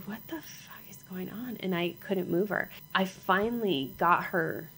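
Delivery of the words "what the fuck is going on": whispered in shock, breathy and hushed; hushed horrified whisper over her daughter. Retold or imitated. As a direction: breathe it out in disbelief. Sees green foot.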